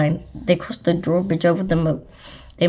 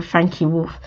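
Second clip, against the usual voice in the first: ordinary delivery, nothing marked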